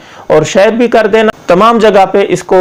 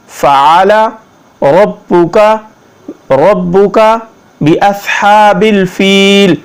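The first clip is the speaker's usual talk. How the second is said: slow word-by-word tajweed; slow Arabic recitation for learners; Quranic recitation lesson; 3 semitones higher, much slower than usual. Shown as a demonstration. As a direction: draw out the long vowels, pause after each phrase. Models the verse word by word.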